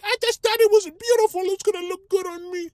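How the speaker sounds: squeaky-high falsetto girl impression; girlfriend's voice. Girlfriend defending her booty shorts, very high mock-female voice. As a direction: play the whining girlfriend way up in falsetto. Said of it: "she's over here like".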